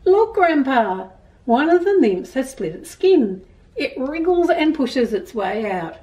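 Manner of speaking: put-on gentle storybook reading to children; reading a story aloud. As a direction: read it like a picture book to kids. Reads picture book to children.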